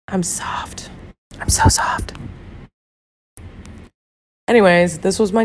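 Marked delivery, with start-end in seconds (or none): 0.1-2.1 soft breathy whispered confession. Real, not acted. Confessing to viewers she is soft, whispered. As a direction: whisper it like a secret.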